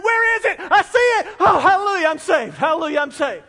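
high, loud laughing search, then a joyful hallelujah; desperate joy at being saved; frantic joy. Retold or imitated. Bitten Israelite spotting the serpent, frantic laughing joy. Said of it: "you're gonna be running around going".

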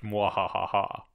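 slow mock mad scientist cackle; drawn-out hammy evil laugh; evil villain laugh. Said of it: "So his evil plan worked".

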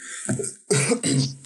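dry rough cough; coughing fit. Real, not acted. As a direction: cough through a failing voice. Cough while losing voice.

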